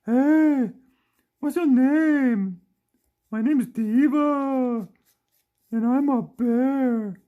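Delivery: loud, high, slow toy-bear voice; Diva. Pretend. Toy bear introduces herself to everyone, funny high voice. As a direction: belt it out high, stretching each word. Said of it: "the only thing she ever says is".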